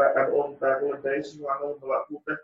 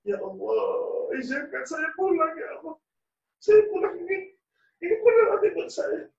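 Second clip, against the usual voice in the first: higher, crying plea to be allowed home; tearful prayer; 13 semitones higher than usual. Acted. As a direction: sob out the choice to go back to mother. (doa sambil menangis dengan suara tinggi)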